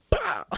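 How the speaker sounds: sharp, very high pow; mock smack sound. Retelling a dad's newspaper hit, sharp high pow.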